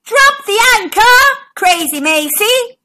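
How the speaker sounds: captain yelling to his crew; yelled order. Acted. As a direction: shout across the deck. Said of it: "he yelled".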